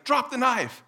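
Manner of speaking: high, loud acted order; cop's shouted command. Cop ordering a woman with a knife, shouting. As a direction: play an armed officer shouting. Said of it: "a cop comes up, pulls his gun, and is like".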